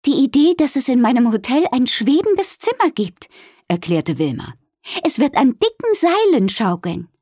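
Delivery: a hint of Wilma explaining. A narrator's reading. Wilma voiced by narrator.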